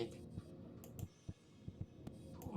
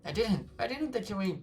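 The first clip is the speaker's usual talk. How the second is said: much higher, louder desperate denial; panicked denial; 8 semitones higher, 5 dB louder than usual. Acted. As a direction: protest your innocence in fear. Player denies to ghost.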